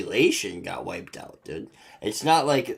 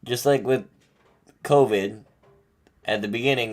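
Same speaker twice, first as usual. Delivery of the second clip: ordinary delivery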